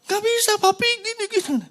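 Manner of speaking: put-on high, loud whining child; boy refusing to share his toy. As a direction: whine back like a stubborn kid. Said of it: "Atau kita ngomong sama anak kita".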